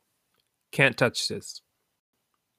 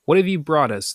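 higher, louder eager asking; excited question; 6 semitones higher, 5 dB louder, faster than usual. A narrator's reading. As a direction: ask it with lifted excitement. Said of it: "they asked excitedly".

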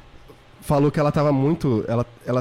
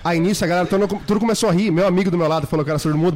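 ordinary talk

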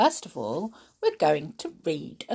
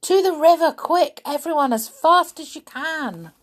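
much higher and louder, raising the alarm; urgent cry; 10 semitones higher, 6 dB louder than usual. Acted. Bagheera and Mowgli scare the elephants, loud urgent cry. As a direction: shout it to start a stampede.

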